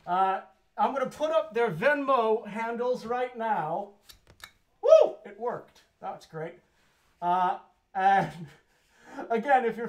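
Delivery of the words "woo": loud, high-pitched "woo"; celebratory whoop. Real, not acted. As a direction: let out a short, high cheer. Cheers when the handles display works.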